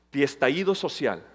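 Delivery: ordinary talk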